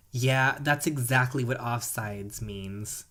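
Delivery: ordinary talk, nothing marked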